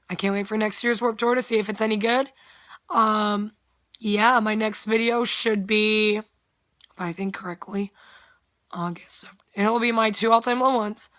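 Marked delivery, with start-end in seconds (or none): 6.2-9.2 a quick hushed aside to yourself; whispered aside. Real, not acted. Vlogger unsure of her next video, whispering aside. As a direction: whisper it under your breath.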